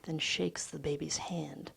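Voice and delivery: ordinary delivery, nothing marked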